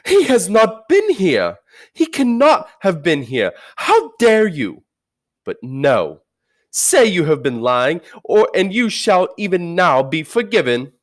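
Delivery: high, loud fury, some words slowed; a queen raging that Father Christmas came, high and loud; furious witch. Acted. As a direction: rage and then offer false mercy.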